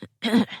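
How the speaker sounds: short, dry throat-clear. A narrator's reading. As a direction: clear your throat before speaking. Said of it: "Lee cleared his throat".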